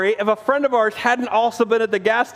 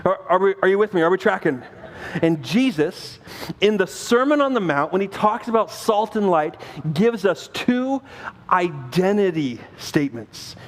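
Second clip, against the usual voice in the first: ordinary talk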